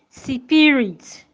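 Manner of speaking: "spirit" said si-pi-rit, in three syllables; inserted vowel. Shown as a demonstration. Teacher repeats the error for learners, vowel inserted in cluster. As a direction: put an i between S and P in "spirit". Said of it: "do not say spirit".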